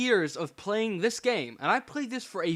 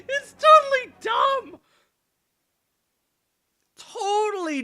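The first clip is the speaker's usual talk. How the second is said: very high, loud, through laughter; laughing shout; 9 dB louder than usual. Real, not acted. Player mocking the game's blinding, high laughing shout. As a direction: squeal it out mid-laugh.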